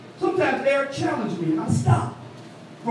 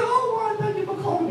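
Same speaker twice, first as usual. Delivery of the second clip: imitating mother-in-law; 11 semitones higher than usual.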